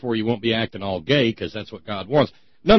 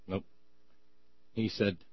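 usual speaking voice

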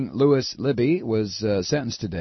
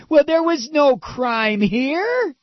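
high, drawn-out whiny protest; hammed-up wail of feigned innocence; slow, high-pitched mock outrage; mock screaming; 13 semitones higher, 3 dB louder, much slower than usual. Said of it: "is screaming".

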